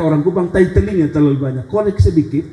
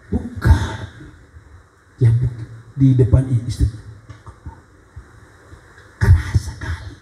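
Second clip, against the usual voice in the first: hushed, lower whisper; whispered reply; 4 semitones lower, 3 dB quieter than usual. Retold or imitated. Wife whispering to husband in church pew. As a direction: whisper back the bad news. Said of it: "Lalu suaminya".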